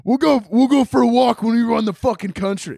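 high manic yell with a stammered start; mock raging bully. Adderall refusing joy a walk, mock rage.

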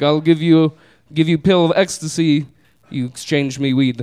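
imitated Eastern European deal-maker; halting Eastern European accent, offering a trade. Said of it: "almost seven foot tall Eastern European man".

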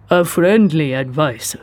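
put-on slow, heavily Japanese-accented English. A narrator's reading. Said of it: "his English heavily accented".

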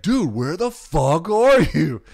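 slow, very loud mock yell, pitched high; imitating a friend cursing down the phone; escalated angry voicemail. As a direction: drag out each word in hammed-up outrage. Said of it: "And then the next one, it's like".

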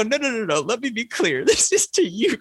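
high, laughing through the words; laughing quote. Retold or imitated. To a friend over a text, laughing high. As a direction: giggle out the correction. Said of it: "I go".

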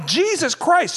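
high, strained cry of exasperation; exasperated outburst. Retold or imitated. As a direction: let it out high, at your limit. Exasperated with cinema talkers.

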